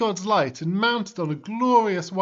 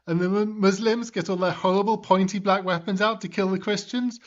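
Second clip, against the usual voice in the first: ordinary delivery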